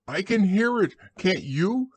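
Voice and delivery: high, worried squeak; anxious Piglet. A narrator's reading. Piglet nears the trap with Christopher Robin, high and anxious. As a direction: ask it nervously in a tiny creature's voice. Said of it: "said Piglet anxiously".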